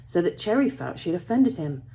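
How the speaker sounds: ordinary delivery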